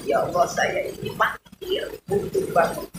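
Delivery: high, breathless whispered prayer; humble whispered confession; whispered plea. Shown as a demonstration. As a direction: plead in a hushed, pleading whisper.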